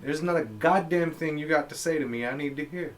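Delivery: high, loud acted dismissal; exasperated loud retort. Acting his refusal to hear the man out, loud exasperation. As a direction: shut the stranger down at full volume. Imagined retort to heckling stranger.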